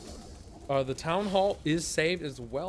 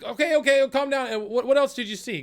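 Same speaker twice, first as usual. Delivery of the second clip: high, loud, hurried crowd voice; crowd calming itself; 12 semitones higher, 7 dB louder than usual. Acted. Townsfolk hushing each other, high and loud. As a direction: settle everyone down while still buzzing. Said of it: "everyone's kinda just, uh, like".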